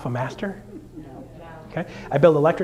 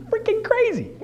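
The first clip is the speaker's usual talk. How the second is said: excited. Real, not acted. Excited about metal.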